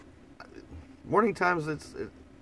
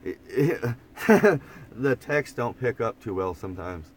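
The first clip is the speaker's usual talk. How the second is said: usual speaking voice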